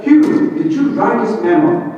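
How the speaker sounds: a mild imitation of the red-faced president confronting him; angry boss. President storming into the office, mock angry question. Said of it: "very angry".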